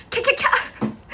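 high, breathy clicking sound of her shoulder; imitated joint crack. Showing viewers the sound her shoulder makes, mock clicks. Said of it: "会说啪".

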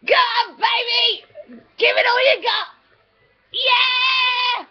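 high, full-voiced egging-on; shouted cheering. Real, not acted. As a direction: cheer loudly for a pet going wild. Cheering the pets on.

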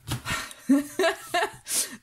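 real burst of high, loud laughter; laughing. Real, not acted. Tarot reader amused by the three draws, laughing. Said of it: "je rigole".

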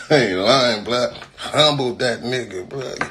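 normal talking voice

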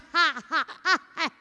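crying laugh. Shown as a demonstration. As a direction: giggle breaking into a sob. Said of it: "tá rindo e chora".

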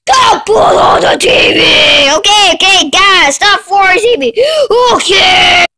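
pushed around over the TV, shouting; shouting argument. Acted. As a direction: yell back under pressure. Arguing over the TV.

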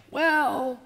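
high, softened, stalling "well"; evasive hedging. Acted. Evasive listener changing the subject.

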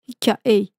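the typo hika-ey read literally; hikaye misspelled as hika-ey. A narrator's reading. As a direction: end hikaye wrongly on "ey". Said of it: "hikayeyi yanlış yazmıştım". (hikaye yerine "hikaey")

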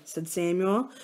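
ordinary delivery

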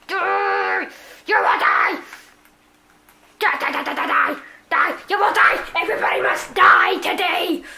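wild screaming at the game. Real, not acted. Boy screaming at enemies while gaming. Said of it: "stop screaming".

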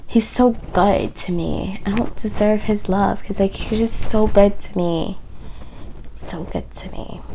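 hushed, self-blaming inner thoughts, trailing off. Retold or imitated. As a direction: mutter it to yourself, falling to a whisper. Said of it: "Now in her head".

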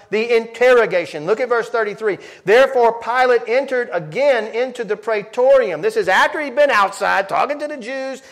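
loud, high preaching at full force; full-voiced pulpit preaching; fervent preaching. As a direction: drive it home to the congregation.

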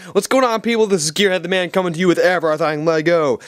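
high-pitched, upbeat host greeting; high-energy intro. Real, not acted. Host greeting viewers to open Lego show, high-energy. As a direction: launch the show with full pep. Real intro after the joke.

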